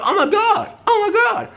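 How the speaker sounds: very high laughing squeal of alarm; mock panicked girl. A girl spotting her weight gain, laughing high squeal. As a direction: squeal it like a girl seeing the scale.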